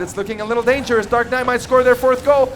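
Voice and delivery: building to an excited shout; rising hype as the attack comes; esports caster. Real, not acted.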